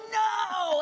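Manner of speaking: sudden high, loud "No!" of a raging dad; hammed-up angry dad yell; mock furious shout. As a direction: bark it out like an exploding father. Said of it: "explode into a rage".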